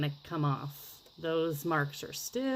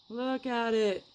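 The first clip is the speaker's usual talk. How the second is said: higher, drawn-out admiration; admiring gush; 5 semitones higher, 4 dB louder, slower than usual. Real, not acted. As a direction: gush over how lovely it looks. Admiring the finished paper.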